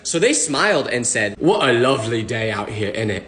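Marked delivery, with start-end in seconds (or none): 1.4-3.2 imitating a British accent, lower. British stranger greets me in a British accent. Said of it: "didn't expect them to have an accent".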